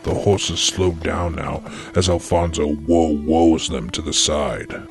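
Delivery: usual speaking voice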